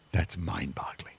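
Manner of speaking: hushed whisper, slow, in wonder; whispered awe. Real, not acted. Preacher hushes to the congregation in wonder, whispered. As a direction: breathe it out as a secret marvel.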